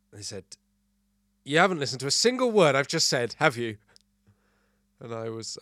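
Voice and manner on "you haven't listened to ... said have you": loud, raised-pitch mock telling-off; hammed-up reproach; acted scolding. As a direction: play an exasperated partner accusing. Acted partner's scolding in joke.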